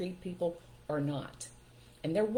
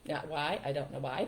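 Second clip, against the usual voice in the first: normal talking voice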